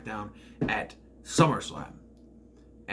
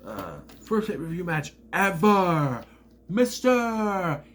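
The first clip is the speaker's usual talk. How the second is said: slow, high, loud bragging; drawn-out higher, louder victory brag; mock gloating shout; 7 semitones higher, 5 dB louder, much slower than usual. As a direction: gloat to the whole arena. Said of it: "he goes".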